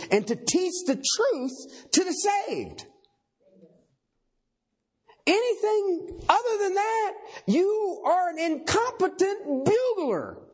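rising, slowed preacher's emphasis; preaching at full force. Real, not acted. Preacher to congregation, building and slowed. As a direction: drive the point home to the congregation.

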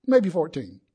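ordinary talk, nothing marked

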